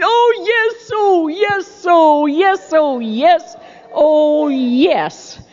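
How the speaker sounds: giddy, bouncing chant of oh yes; excited cheering. Retold or imitated. Little girl jumping through the house, giddy and excited. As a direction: chant it like a kid jumping for joy. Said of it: "Candy's jumping up and down through the house going".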